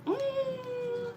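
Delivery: a high, amused skeptical tone. Retold or imitated. Answering people who guess New Orleans, high and skeptical. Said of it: "And I'm like".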